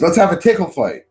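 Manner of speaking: high, quick, playful pitch; imitated eager invitation. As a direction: invite like an overexcited brat. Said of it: "She goes".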